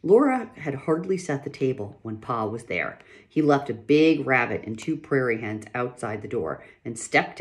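lively read-aloud voice with characters; story narration. A narrator's reading. Reader performing a pioneer family chapter, storytelling voice. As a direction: read the chapter to listeners with feeling.

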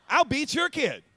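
high, loud mock threat. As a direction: call out a playful threat.

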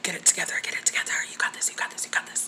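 soft unvoiced self-encouragement; whispered pep talk. Real, not acted. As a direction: whisper to steady yourself. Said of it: "I'm, like, starting to shake".

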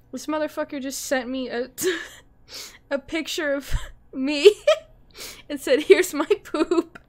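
laughing through the words; crying with laughter. Real, not acted. Laughing-crying to chat about the picture, hysterical.

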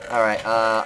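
ordinary talk, nothing marked

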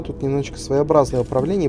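ordinary talk

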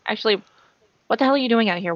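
ordinary talk

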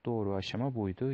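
normal talking voice

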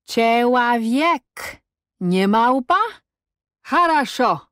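high, drawn-out syllable-by-syllable taunt; mocking joke. A narrator's reading. Cossack jokes at captive's answer, drawn-out mockery. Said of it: "dzieląc sylaby wyrazu, zażartował".

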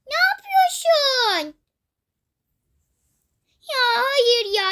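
put-on pushed child bursting into tears; crying protest. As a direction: whine it through tears.

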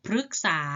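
พฤกษา with ฤ said "rue". Shown as a demonstration. Teacher models for pupils, พฤกษา with short "rue". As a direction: say พฤกษา as phruek-sa. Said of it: "ออกเสียงรึ".

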